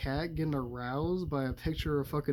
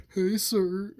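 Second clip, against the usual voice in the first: lightly voiced hippie, higher and louder; mock-friendly hippie call, pitched up; hippie impression; 6 semitones higher, 6 dB louder than usual. Cat as hippie accosts Alec Baldwin, lightly voiced hippie. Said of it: "dresses up as a fucking hippie".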